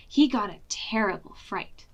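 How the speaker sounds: plain reading voice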